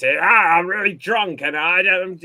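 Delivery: loud slurred drunk impression; drunk bad comedian. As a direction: play a horrible comedian drunk on stage. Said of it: "And he'd be doing this".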